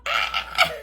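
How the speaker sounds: very high shriek of joy; shriek, overwhelmed with delight; piercing happy squeal; excited scream. Real, not acted. Screams at a pile of stickers.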